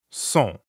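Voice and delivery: "Sont" with a nasal vowel, n not sounded. Shown as a demonstration. Teacher demonstrates "Sont" to a learner, nasal vowel. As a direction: rhyme "Sont" with French bon. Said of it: "Sont is nasal".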